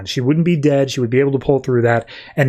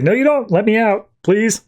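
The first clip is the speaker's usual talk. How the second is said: lightly acted, higher-pitched plea; mock pleading; 7 semitones higher than usual. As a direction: beg to be let out, half in earnest. Trapped Mister E begs for release.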